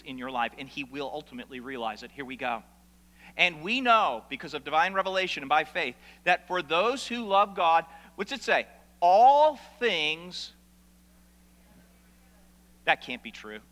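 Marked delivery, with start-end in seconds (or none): 3.4-10.5 emphatic scripture reading. Acted. Reading Romans 8 with force.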